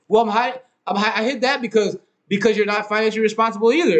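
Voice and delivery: put-on girlfriend's retort. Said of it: "it's just like".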